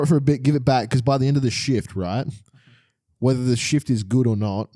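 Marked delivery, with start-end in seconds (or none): none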